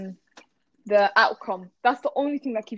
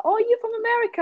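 high, surprised you're-from-America; mock surprised question; 12 semitones higher than usual. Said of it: "some people used to always be like".

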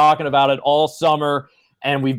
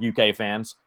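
normal talking voice

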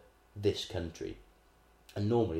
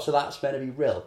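higher, louder incredulous question; mock disbelief; 6 semitones higher, 7 dB louder than usual. Mocking the film's Rhyl to a friend, incredulous. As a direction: sound baffled at the film. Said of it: "And I was like".

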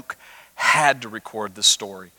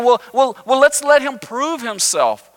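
grudging Pharisees; 9 semitones higher than usual. Retold or imitated. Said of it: "They're thinking".

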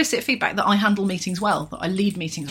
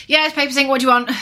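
loud, high, impatient phone greeting; rude phone answer; 7 semitones higher, 6 dB louder than usual. Pretend. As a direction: bark it like a grumpy receptionist. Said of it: "in the manner of Ghostbusters".

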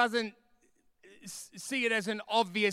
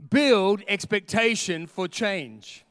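preacher raising the volume; louder slow proclamation; 6 dB louder, slower than usual. Shown as a demonstration. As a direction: build expectation for change, slow and louder. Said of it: "I need to say that one more time".

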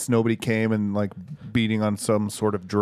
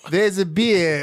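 broad Australian twang, higher pitched; Australian accent; 6 semitones higher, much slower than usual. Acted. Friend reading a beer slogan in an Australian accent. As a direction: go full Aussie on the first words. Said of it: "it always goes Australian halfway through".

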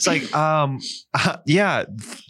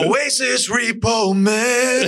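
high, drawn-out mock title call; 9 semitones higher, much slower than usual. Host announcing the made-up sketch, drawn-out call. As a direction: stretch it like a theme shout.

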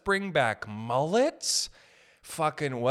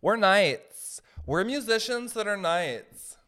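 mock knight; 7 semitones higher, 4 dB louder, slower than usual. Imagined knights announcing themselves.

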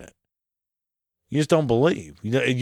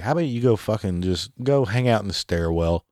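ordinary talk, nothing marked

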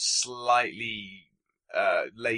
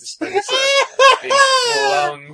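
very high, loud laughing cry; laughing shout; 12 dB louder than usual. Real, not acted. Laughing at the Pez gag.

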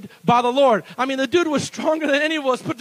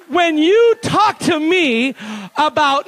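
normal talking voice

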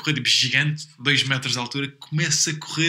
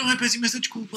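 mock pleading; 7 semitones higher, 5 dB quieter than usual. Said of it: "fiz uma choradinha".

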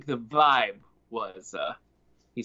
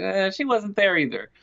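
high whiny excuse; 7 semitones higher, 3 dB louder than usual. Retold or imitated. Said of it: "she's like".

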